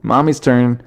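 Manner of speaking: a hint of a small kid announcing; daughter's little voice. Daughter calling for mommy's turn, lightly imitated child voice. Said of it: "she goes".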